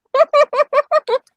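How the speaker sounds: high-pitched mock giggling fit; re-enacted high titter; imitated nervous giggle. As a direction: giggle helplessly, as if you shouldn't be. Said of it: "I'm just sitting here going".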